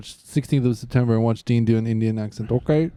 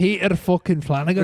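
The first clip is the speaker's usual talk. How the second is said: put-on Irish accent; 4 semitones higher than usual. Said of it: "Irish accent".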